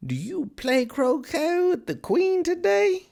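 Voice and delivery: very high, slow cat voice; inquisitive Cheshire Cat. A narrator's reading. Cat asking Alice about croquet, high and slow. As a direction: swoop up high and stretch the question.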